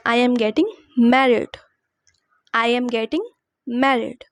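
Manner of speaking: very slow, each word spaced for learners; slow dictation. Shown as a demonstration. Teacher modelling a sentence for students, very slow. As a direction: spell it out slowly so students can copy. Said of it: "तो आप इस sentence को English में बोलोगे".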